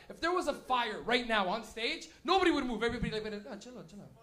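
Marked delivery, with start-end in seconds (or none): 3.5-4.1 lazy mumble. Retold or imitated. Stoned crowd during fire.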